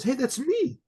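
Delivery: high-pitched startled recognition, re-enacted; surprised realization. Recognizing himself in the stranger's phone video, surprised. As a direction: exclaim it as it dawns on you.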